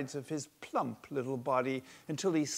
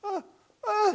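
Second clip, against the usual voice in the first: shocked cry; 10 dB louder than usual. A narrator's reading. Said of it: "she cries out in shock".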